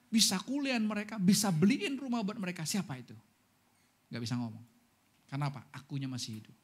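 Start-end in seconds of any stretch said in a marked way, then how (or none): none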